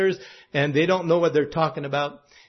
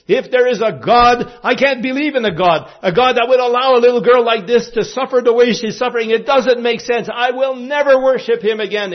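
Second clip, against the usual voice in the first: raised, higher angry protest; uncle's rant; 5 semitones higher, 4 dB louder than usual. Retold or imitated. Uncle ranting at the party about his sick daughter, angry protest. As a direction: voice the uncle's bitter outburst. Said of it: "He started ranting".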